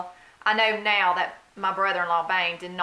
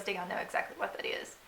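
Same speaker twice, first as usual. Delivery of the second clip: hushed, voiceless, much softer; retold whisper; 8 dB quieter, faster than usual. Husband quietly telling his wife he knows the diagnosis, whispered. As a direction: whisper it like a husband leaning over. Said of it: "he whispered to me".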